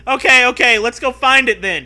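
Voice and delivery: high, loud eager reply; Zoe's eager voice. Acted. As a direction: answer keen and loud. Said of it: "she replies".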